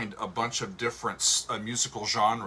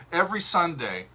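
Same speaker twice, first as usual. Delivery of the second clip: ordinary talk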